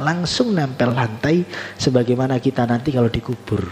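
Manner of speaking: ordinary talk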